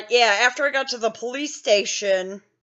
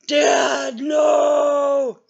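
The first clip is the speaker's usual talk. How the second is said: drawn-out, higher wail of dread; mock dread; 5 semitones higher, 4 dB louder than usual. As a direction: wail it like a kid in mock horror. Child dreading father's whooping, acted.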